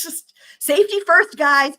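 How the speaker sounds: high, loud warning through laughter; cracking up. Real, not acted. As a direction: call it out while fighting giggles. Warns viewers while cracking up.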